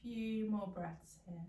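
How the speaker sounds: soft, low meditation voice; guided meditation. Real, not acted. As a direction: guide them gently through the last breaths, softly. Leads a meditation.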